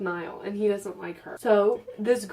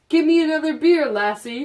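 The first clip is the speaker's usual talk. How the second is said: louder, higher gruff mock drunk dad; 7 semitones higher, 8 dB louder than usual. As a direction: bark it like a tipsy father. Drunk dad voiced in story.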